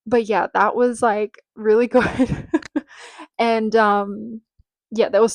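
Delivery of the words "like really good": usual speaking voice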